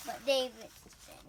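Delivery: ordinary talk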